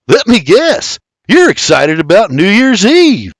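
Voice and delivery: cutting in. Acted. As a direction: cut him off with a smirk. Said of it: "William cuts him off".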